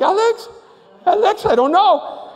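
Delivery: high, loud panicky calling of Alex; scared calling out. Retold or imitated. Delbert calling his brother as the ride starts, high panicky voice. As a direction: call for Alex in rising panic.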